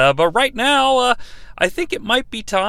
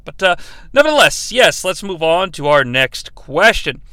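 usual speaking voice

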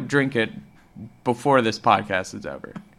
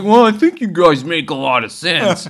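impersonating Joe Rogan; 3 semitones higher, 6 dB louder than usual. Retold or imitated. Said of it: "He's like".

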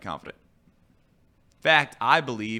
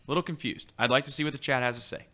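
ordinary talk, nothing marked

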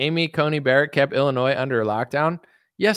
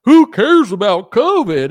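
louder, slow, dismissive mock voice; higher, slow mock of a careless voter; mocking Trump supporter; 6 semitones higher, 9 dB louder, slower than usual. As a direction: sneer it out like a careless fan. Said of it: "Trump supporters like".

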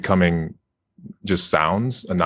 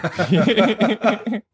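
laughing; 14 semitones higher, much slower than usual. Real, not acted. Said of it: "laughs mid-sentence".

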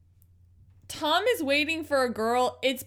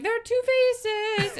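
high, slow mock-operatic line; mock soprano; 11 semitones higher, 4 dB louder, slower than usual. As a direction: imitate the soprano's ominous line. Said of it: "They're like".

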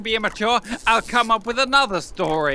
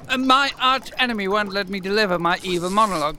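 ordinary talk, nothing marked

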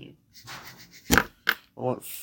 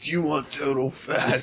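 slow, louder ad-pitch voice, half joking; mock commercial guy; 3 semitones higher, 8 dB louder, slower than usual. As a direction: sell it like a cheesy TV ad. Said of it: "I just thought of the guy. In the commercial".

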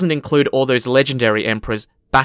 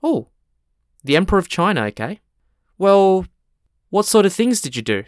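surprised; 6 semitones higher than usual. Acted. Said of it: "The gatekeeper is slightly surprised".

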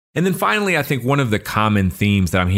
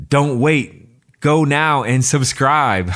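higher, louder, slow pitchman push; infomercial voice; 6 semitones higher, 3 dB louder, much slower than usual. Pretend. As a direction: sell it like a TV infomercial. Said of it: "That was the best infomercial voice".

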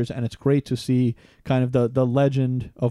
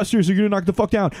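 fast, raised scolding; mock yelling; 5 semitones higher, much faster than usual. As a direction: rattle off an angry aside between sung phrases. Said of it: "go right to yelling at these people".

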